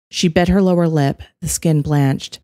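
ordinary delivery, nothing marked

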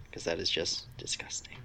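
whispered aside. Real, not acted. Friend denying lewd Webms in a whisper. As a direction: hush it like a secret.

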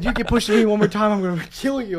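hammed-up angry threat; mock threat. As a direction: bark it like a hothead in a pit. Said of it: "He said".

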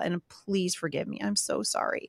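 soft, contrite plea; pleading apology. Retold or imitated. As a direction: ask forgiveness quietly, voice dropping. Mother begging kids' forgiveness.

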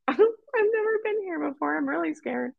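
very high, squeaky little whimper; mock scared. Scared newcomer at a brawl, high mock whimper. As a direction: squeal it like a frightened newcomer, exaggerated.

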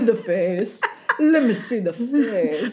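slow, lightly Italian doting voice; doting Italian nonna. Pretend. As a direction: coo it like an Italian grandma. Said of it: "It's almost like an Italian".

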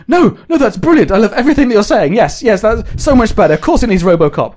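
high, fast over-eager gushing; gushing enthusiasm. Pretend. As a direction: fawn over every idea. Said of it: "It might be that they're like".

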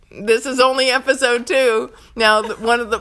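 ordinary delivery

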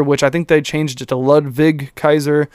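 normal talking voice

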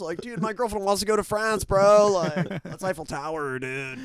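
high-pitched excited bro; impressed dude. Acted. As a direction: gush like a starstruck party dude. Acts an impressed Orange County dude.

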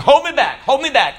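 high, loud fake-fighting shout of hold me back; mock tough-guy yell. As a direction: ham up a guy pretending he wants to fight. Mocking angry person held back.

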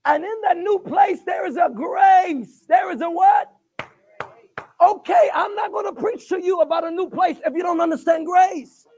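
loud call-and-response preaching; preaching at full force. Acted. Pastor calling the congregation to answer, loud preaching. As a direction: shout it out, pulling the crowd in.

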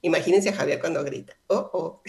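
normal talking voice

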